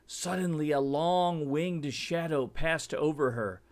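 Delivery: ordinary delivery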